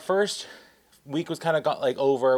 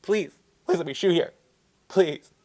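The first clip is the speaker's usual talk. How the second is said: higher-pitched mock-crying plea; retold tearful begging; 4 semitones higher than usual. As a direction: beg dramatically, as if crying. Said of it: "I'm crying".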